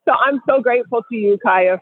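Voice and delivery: usual speaking voice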